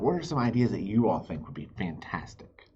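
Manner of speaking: usual speaking voice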